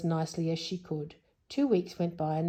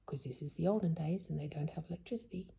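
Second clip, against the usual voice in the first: fast, hushed explaining whisper; whispered aside; 8 dB quieter, faster than usual. Real, not acted. Reader whispering an explanation to the child. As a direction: slip in a quick whisper.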